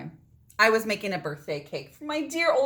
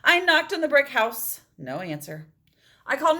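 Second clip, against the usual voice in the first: usual speaking voice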